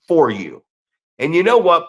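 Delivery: ordinary talk, nothing marked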